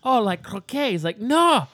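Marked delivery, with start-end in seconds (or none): 0.0-0.9 high-pitched Scottish questioner; imitated Scottish stand-up bit; Robin Williams' Scottish accent. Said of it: "Robin Williams' skit about how someone made golf". 1.2-1.6 put-on high, slow drunken Scot; imitated Scottish comedy rant; Robin Williams' Scottish accent. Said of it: "drunken bastard is like".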